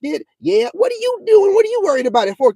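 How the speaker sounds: high gossipy mock voice; mock gossip. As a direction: squeak it like a nosy church gossip. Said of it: "telling you about".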